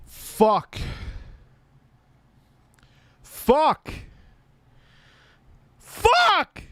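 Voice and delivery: escalating cries of pain; building shout. Real, not acted.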